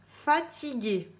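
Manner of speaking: "Fatigué" étiré, lent et plus haut; traîne "Fatigué" pour la dictée; dictée lente. Shown as a demonstration. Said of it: "Vous écrivez fatigué". (dictée lente et appuyée)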